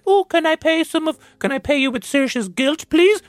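squeaky hammed-up beg to the bill man; mock pleading customer. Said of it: "She's like".

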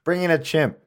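calling out. Pretend. Calling producer to bring chimp.

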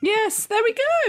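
high, drawn-out delight; delighted cheer. Real, not acted. Co-host hailing the solution of a Guinness order, delighted cheer. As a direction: celebrate it with a soaring, stretched cheer. Delighted the drink idea is solved.

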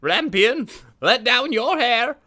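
high, loud witch call. Acted. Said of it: "she would stand below and would cry".

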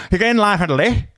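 high-pitched, slurred mock British accent; imitated British accent. Stranger asking about the bathroom queue, garbled British accent. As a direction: run it together like an unintelligible Brit. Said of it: "this guy comes up to me and he's like".

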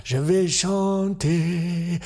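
milk every syllable, fading to the end; Gene Kelly. Acted. Kelly drawling mock French to a finish.

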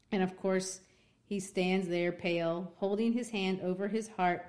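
ordinary talk, nothing marked

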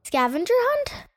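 high disbelieving question; incredulous. Acted. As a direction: sneer at the very idea. Penny dismayed at camp activity.

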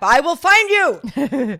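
slow, loud menacing yell; mock threat shout. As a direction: bellow it like a movie villain.

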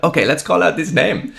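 high, eager German soldier; put-on German-accented English. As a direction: propose it in a comic German accent. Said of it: "German accent in English very well".

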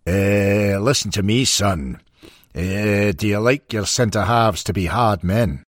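put-on friendly Scottish accent, a touch of humour; lightly voiced Scottish manager, warm and teasing; Scottish burr. A narrator's reading. Shankly gently turns down young Taylor, in a Scottish accent. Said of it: "With his unmistakable Scottish burr".

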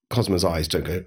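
ordinary talk, nothing marked

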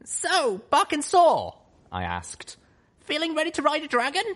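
high, bright eager pony; excited filly. Acted. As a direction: ask eagerly, bouncing. Lil Pip excited.

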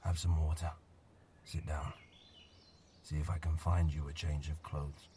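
put-on low, soft voice of a gentle old man; a weary old man coaxing a stranger softly; quiet old man. Old man character speaks.

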